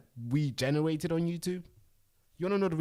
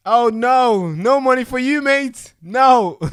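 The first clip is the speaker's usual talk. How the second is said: much higher, much louder gloating no; mocking taunt; 12 semitones higher, 17 dB louder, slower than usual. Host voicing YouTube refusing pay, loud high mock taunt. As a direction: jeer like a playground bully.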